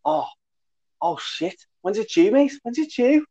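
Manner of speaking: very high, alarmed Scouse lad; Scouse accent. Retold or imitated. Lad just learning of the dissertation deadline, in Scouse accent. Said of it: "that was my best scouse accent".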